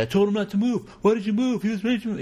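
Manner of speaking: high, strained cop pleading his case; panicked cop. Retold or imitated. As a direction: squeal it out like a frantic cop justifying himself. Panicked cop after shooting.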